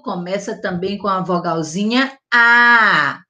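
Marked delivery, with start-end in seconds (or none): 2.3-3.2 long loud drawn-out "A"; held vowel A. Shown as a demonstration. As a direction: stretch the "A" open and loud for the class. Teacher models vowel sound.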